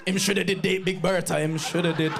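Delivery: light Jamaican patois mourners' voice; a hint of Jamaican funeral gossip; Jamaican mourners. Family at the funeral, gossiping in a light Jamaican accent. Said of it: "We're at the funeral like".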